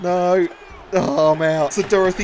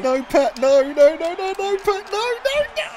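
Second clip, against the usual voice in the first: frantic no, no, no, getting higher; rising panicked no; 12 semitones higher than usual. Real, not acted. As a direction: pitch climbing higher with each no. Panicked at game commentary.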